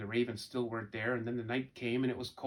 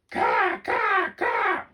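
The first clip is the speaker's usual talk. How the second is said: shrill loud caw, much higher; raven cawing; 8 dB louder, much slower than usual. Acted. Angry raven yelling down from the oak tree. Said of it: "called the third raven".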